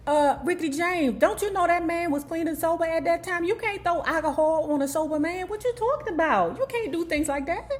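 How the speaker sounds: high, pleading reproach; indignant scolding. Acted. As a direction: scold him like he should know better. Scolds [name] directly.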